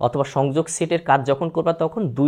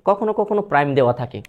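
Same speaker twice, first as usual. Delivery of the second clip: ordinary talk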